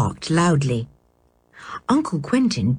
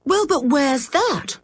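demanding; 8 semitones higher, slower than usual. A narrator's reading. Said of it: "demanded Dick".

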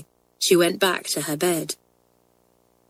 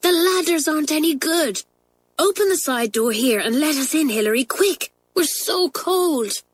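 higher, urgent pleading schoolgirl; pleading girl; 9 semitones higher than usual. A narrator's reading. Pat begging Hilary to open the door, urgent plea. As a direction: beg her to hurry, shivering.

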